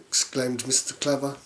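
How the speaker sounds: usual speaking voice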